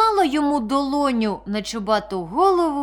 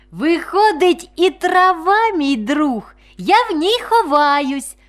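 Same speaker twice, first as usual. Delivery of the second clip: put-on higher, louder childlike reasoning; little fawn; 5 semitones higher, 5 dB louder, slower than usual. A narrator's reading. The fawn works out who its friends are, high-voiced. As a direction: piece it together brightly, like a small creature. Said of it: "зробило висновок оленятко".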